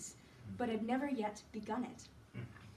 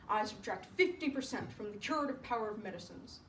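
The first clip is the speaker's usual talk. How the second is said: a hint of an authoritative doctor; lightly voiced doctor. Doctor advising Sue on his patient, measured and firm. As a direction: pronounce the verdict in a lightly put-on doctor's voice.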